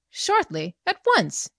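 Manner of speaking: high, indignant retort on at once; sharp retort. Acted. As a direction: snap back, demanding it now. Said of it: "she retorted".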